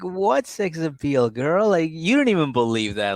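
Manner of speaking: mocking disbelief at the contestant; incredulous scoff. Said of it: "I'm like".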